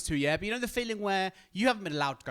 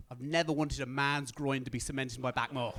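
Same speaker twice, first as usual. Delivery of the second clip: usual speaking voice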